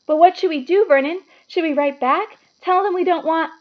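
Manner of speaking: a frightened wife asking her husband; anxious wife. A narrator's reading. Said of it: "Aunt Petunia".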